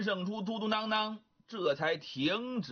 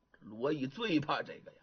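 usual speaking voice

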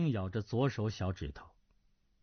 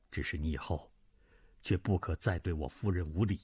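lower, subdued voice of a middle-aged lord; older nobleman, gentle; 9 semitones lower than usual. Acted. Duan Zhengchun voice. (低沉温和的中年男子)

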